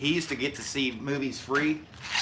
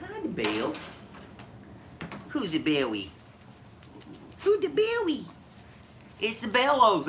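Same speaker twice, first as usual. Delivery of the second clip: much higher, silly pet voice; pet talk to the dog; 9 semitones higher than usual. Real, not acted. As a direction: coo at the dog playfully. Talking to his dog.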